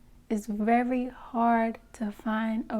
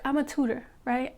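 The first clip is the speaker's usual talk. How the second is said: usual speaking voice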